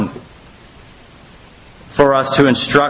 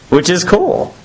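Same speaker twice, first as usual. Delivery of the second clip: usual speaking voice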